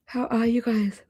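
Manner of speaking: words stretched by a rising yawn; yawning while talking. Real, not acted. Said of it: "I don't know why I'm yawning".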